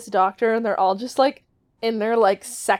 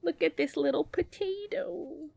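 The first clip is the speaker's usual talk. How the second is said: cooing at a pet; 6 semitones higher, 12 dB quieter than usual. Real, not acted. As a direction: coo softly at the cat. Cooing at cat in lap.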